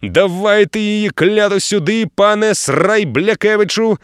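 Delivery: a lightly voiced sea captain, slow; gruff captain. A narrator's reading. Captain cheering the fisherman on, slow gruff praise. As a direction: drag the words like an old salt. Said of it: "похвалив капітан".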